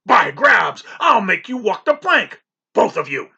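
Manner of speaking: loud shouted threat; furious captain threat. A narrator's reading. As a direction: threaten to make them walk the plank.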